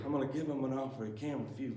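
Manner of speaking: soft, hushed and fast; lightly imitating a quiet mob boss; whispery Godfather. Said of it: "always slow, whispery".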